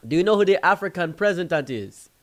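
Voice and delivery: high, loud imitated question; African friend quizzing. African friend testing the group over there, calling out. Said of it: "Then he said".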